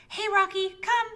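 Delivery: high, loud coaxing call; calling a dog. Acted. Owner calling a disobedient pet to come, loud coaxing.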